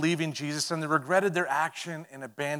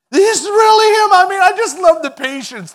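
much higher and louder, thrilled cry; amazed exclamation; 16 dB louder than usual. Acted. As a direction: shout it in joyful shock. Acted amazed disciple.

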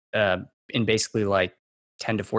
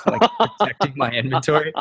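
higher, laughing through the words; 6 semitones higher, 4 dB louder than usual. Real, not acted. Joking about guarding a fireworks stand, laughing. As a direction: let a real laugh carry the line.

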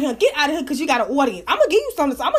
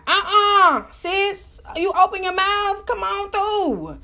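higher, sassy and drawn out at the end; mocking sass; 7 semitones higher, much slower than usual. Real, not acted. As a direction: tease them with mock pity. Mocking famous people who cry.

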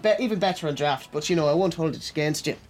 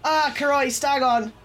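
high, lively Irish farmer calling out; Irish accent, demonstrated to a friend who can't do one; 6 semitones higher, slower than usual. Shown as a demonstration. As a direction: show him how an Irish man says it. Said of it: "Hit me with your best".

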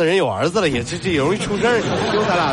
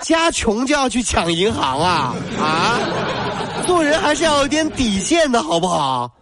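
indignant; 7 semitones higher than usual. Pretend. Mock outrage at victim blaming.

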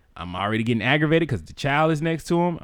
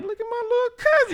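much higher, proud mock gush; proud amazed. Him proud of his cousin handling the boyfriend, high mock gush. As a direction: gush over your little cousin.

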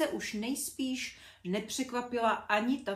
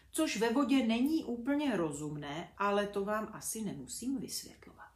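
ordinary delivery, nothing marked

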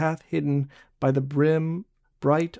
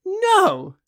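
much higher sharp refusal; firm no; 3 dB louder than usual. A narrator's reading. Barbe refuses Jean, firm and high. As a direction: shut him down playfully. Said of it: "very positive shake of her small head".

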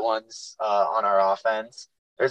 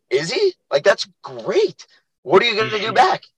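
mock thrilled; 10 semitones higher than usual. Coach mock-excited at an upset player being trash-talked. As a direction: feign delight to cheer the kid up. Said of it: "And I'm like".

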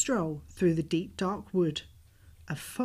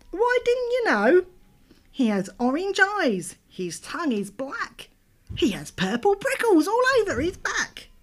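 little mouse voice; 11 semitones higher, 6 dB louder than usual. Acted. Mouse describes the Gruffalo.

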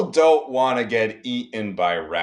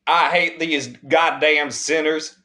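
mock Southern preacher; 7 semitones higher, 6 dB louder than usual. Mocking the cohost's angry Jesus freak, hammed-up Southern accent. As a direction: rant like a furious Southern Bible-thumper, hamming it up. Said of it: "awful Southern accent".

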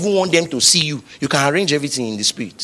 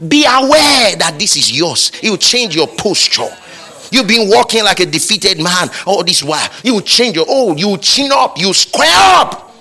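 full-force preaching; 7 semitones higher, 5 dB louder than usual. Acted. Preacher telling defeated listeners to chin up, loud rising exhortation. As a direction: lift them out of defeat with your voice.

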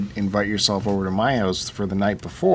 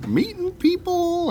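sarcastic; slower than usual. Acted. Teasing co-host.

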